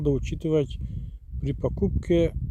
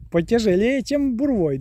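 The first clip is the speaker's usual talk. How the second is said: laughing, much higher voice; 7 semitones higher, 4 dB louder than usual. Real, not acted. Joking to viewers the word is harder, laughing. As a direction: laugh it out, amused.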